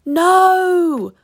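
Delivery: long, high cry of protest; drawn-out, high-pitched no; cried out. A narrator's reading. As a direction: cry it out high to stop someone. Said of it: "we cried in unison".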